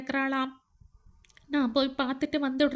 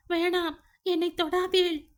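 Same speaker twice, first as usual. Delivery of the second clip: wife's pained shout, higher; 8 semitones higher than usual. A narrator's reading. Burnt wife shouts at husband to keep away. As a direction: cry out don't touch me. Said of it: "என்று கத்தினாள்".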